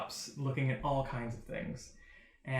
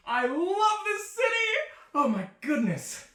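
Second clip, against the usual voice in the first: flamboyant delight; 10 semitones higher, 7 dB louder than usual. Acted. Flamboyant stranger gushing loudly to the party. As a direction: proclaim your love for the city, then swoon.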